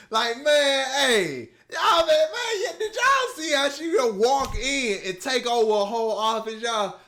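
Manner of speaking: loud, high excited yelling; hyped shouting. Real, not acted. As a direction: shout it out, thrilled. Hyped about Ada taking over.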